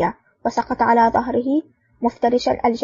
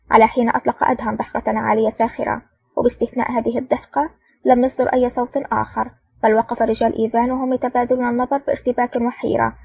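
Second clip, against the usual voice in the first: usual speaking voice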